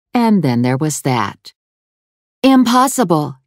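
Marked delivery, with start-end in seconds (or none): 2.4-3.4 higher denial feigning innocence; fibbing. A narrator's reading. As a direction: protest too quickly, covering a lie. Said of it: "Caroline fibbed".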